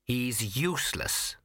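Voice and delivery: slow, drawling, haughty cat; sniffy, very slow cat voice; snooty bored cat. Acted.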